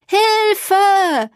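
desperate yell across the waves; cry for help. A narrator's reading. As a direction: shout it from far away in panic. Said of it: "tönte es wieder".

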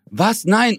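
dismayed protest. Retold or imitated. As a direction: protest as she's taken away. Being separated from her.

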